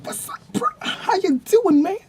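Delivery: high, pushed-up bro slang imitation; mock the guy acting street. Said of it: "this dude goes".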